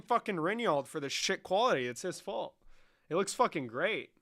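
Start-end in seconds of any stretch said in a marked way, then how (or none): none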